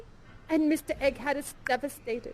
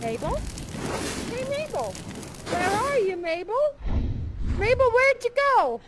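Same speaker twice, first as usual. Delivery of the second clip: calling for Mabel; 5 semitones higher than usual. Acted. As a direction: call out for his missing sweetheart. Cowboy searching town for Mabel.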